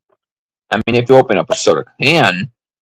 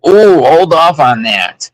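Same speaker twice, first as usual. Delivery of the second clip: excited; 9 semitones higher, 7 dB louder, slower than usual. Retold or imitated. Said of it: "I was like".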